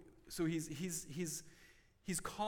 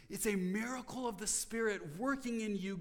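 ordinary delivery

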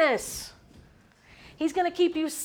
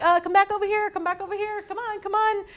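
put-on higher, quick calling to kids; raised, hurried come-here call; parent calling out; 7 semitones higher, 4 dB louder, faster than usual. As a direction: urgently call the children back over.

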